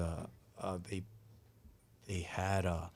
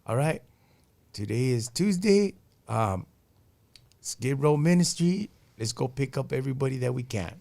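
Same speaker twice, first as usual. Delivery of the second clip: imitating a pastor; 3 semitones higher, 7 dB louder than usual. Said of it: "he's all like".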